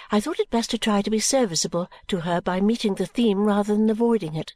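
usual speaking voice

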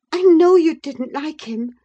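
high, loud, eager voice; barely repressed delight. A narrator's reading. Wife answers her cross husband, barely repressed delight. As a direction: hold the delight in only a little. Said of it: "repressing a little the intensity of her delight".

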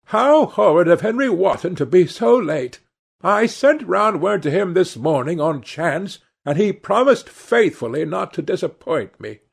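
high-pitched indignant exclamation; put-out hostess exclaiming about a late guest. A narrator's reading. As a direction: exclaim it high, in mild reproach.

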